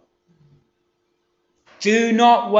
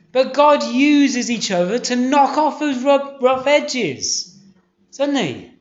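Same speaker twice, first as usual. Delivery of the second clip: high, animated pulpit banter; fired-up, teasing sermon delivery; impassioned preaching; 7 semitones higher than usual. Real, not acted. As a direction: preach it pitched up and punchy.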